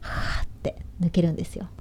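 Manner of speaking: airy whispered demo of the breath; breathy whisper. Shown as a demonstration. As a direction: let the air leak out softly.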